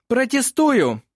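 loud, high courtroom objection; cutting objection. A narrator's reading. Opposing lawyer cuts off the witness, objecting loudly. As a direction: interrupt forcefully. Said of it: "оборвал Сёренсен".

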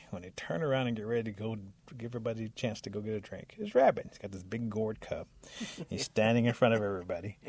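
plain reading voice

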